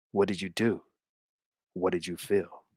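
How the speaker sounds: very soft, low mock-drama; low, hushed soap-opera suspense; hushed questioning. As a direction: act out an intimate, loaded question. Acts tense, intimate questioning.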